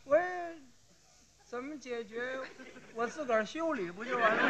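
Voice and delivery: soft, halting climb-down; falter, then give in quietly; sheepish backing down. Acted. Cyclist gives up his claim before the policeman, meek and halting.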